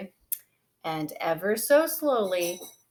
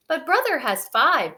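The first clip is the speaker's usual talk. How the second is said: complaining; 6 semitones higher, 5 dB louder than usual. Acted. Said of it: "cried Sister".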